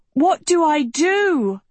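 high, stretched-out cry; panicked shout. A narrator's reading. Agatha mobbed by animals asks Uma for help, panicked shout. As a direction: call out desperately, drawing it out. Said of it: "Agatha shouted".

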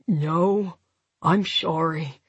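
low, tired, slurred apology, sorry as "shorry"; weary, slurred. A narrator's reading. Injured man to his partner, weary and slurred. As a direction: wearily apologise through a numb lip. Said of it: "he said wearily".

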